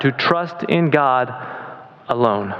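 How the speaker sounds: alone said slow and low, set apart after a pause; stress on alone. Shown as a demonstration. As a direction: lean on alone, let it land. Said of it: "Emphasis on alone".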